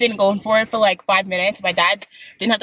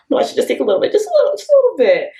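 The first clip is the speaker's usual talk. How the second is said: pleading inner voice; 8 semitones higher, 5 dB louder, much faster than usual. Retold or imitated. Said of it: "I'm just like".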